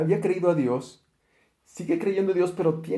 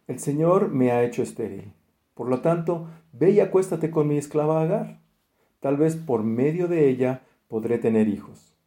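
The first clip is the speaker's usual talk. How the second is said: louder, declaiming Bible reading; scripture reading; 3 semitones lower, 4 dB louder than usual. Acted. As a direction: proclaim the verses to the congregation. Reads Genesis passage aloud.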